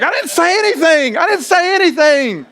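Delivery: high-pitched innocent denial; defensive protest. Retold or imitated. Husband protesting to his wife in a high innocent voice. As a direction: plead your innocence. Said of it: "I'm like".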